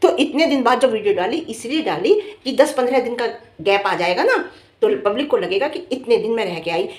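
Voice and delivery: usual speaking voice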